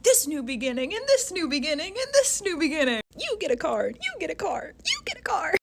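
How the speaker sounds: high-pitched, mounting giveaway excitement; excited giveaway host. Acted. Aries season handing out new beginnings to everyone, excited giveaway announcer.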